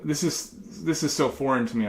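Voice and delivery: ordinary delivery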